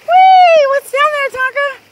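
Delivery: high, loud delighted whee; thrilled call to the dog; excited cheer. Real, not acted.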